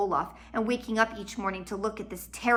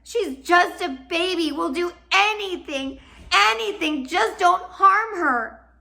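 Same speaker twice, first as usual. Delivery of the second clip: high, loud, slow tearful begging; tearful pleading; 10 semitones higher, 8 dB louder, slower than usual. Acted. Sister begging the villain through tears, high and slow. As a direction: beg through tears for the baby. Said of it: "feeling tears in her eyes".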